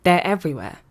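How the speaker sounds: normal talking voice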